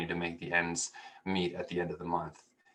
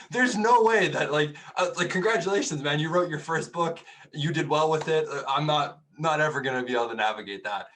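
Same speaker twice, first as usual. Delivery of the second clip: higher, louder mock disbelief; incredulous dismissal; 6 semitones higher, 6 dB louder than usual. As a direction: brush it off as absurd. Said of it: "I literally laughed it off".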